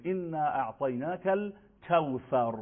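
slow "انا اعطیناک الکوثر" with th in الکوثر; "انا اعطیناک الکوثر" slowly, ث as th; Arabic recitation demo. Shown as a demonstration. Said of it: "سورهٔ کوثر رو بخونه".

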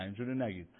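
ordinary delivery

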